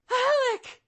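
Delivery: high desperate shriek of a name; crying out a name. Acted. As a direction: scream his name as he is struck. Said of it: "crying out to him and calling him".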